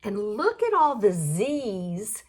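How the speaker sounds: normal talking voice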